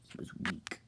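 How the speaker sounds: whispered, cold dismissal; whispered villain line. Retold or imitated. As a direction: breathe it out with contempt. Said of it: "Sivan's like".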